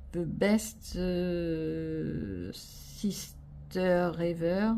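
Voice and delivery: slow, careful English in a French accent. Shown as a demonstration. Vlogger reading an English sticker to viewers, slow French-accented English. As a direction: stretch the English words out slowly, French accent. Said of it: "Hurlez pas, ne vous moquez pas".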